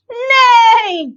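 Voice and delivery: loud, high horse neigh from a goose; high, drawn-out animal call; neigh with all her might. A narrator's reading. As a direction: belt out a big neigh. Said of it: "with all her might".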